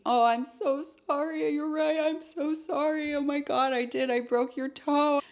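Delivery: high, whining mock apology; very sorry. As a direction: imitate a boyfriend gushing with remorse. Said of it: "he's so sorry".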